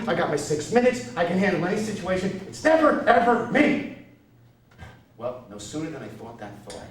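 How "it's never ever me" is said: high pitched never, ever; whiny complaint. Retold or imitated. Whining repeat.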